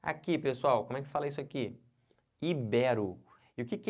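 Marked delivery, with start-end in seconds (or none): 2.4-3.2 "Ibero" as i-BÉ-ro; stress on BE. Shown as a demonstration. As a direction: put the weight on an open BE in "Ibero". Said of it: "O certo é ibero". ("Ibero" com a tônica no BÉ)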